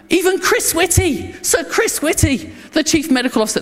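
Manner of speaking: a dignitary gushing about famous locals, excited. Acted. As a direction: pitch it up like a thrilled host boasting.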